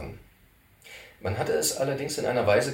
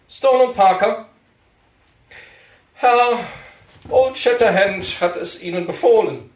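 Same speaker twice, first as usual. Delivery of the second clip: put-on fussy old cantor; 12 semitones higher, 11 dB louder than usual. Cantor correcting Poller about his title, high and fussy. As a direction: insist on your proper title, prissily.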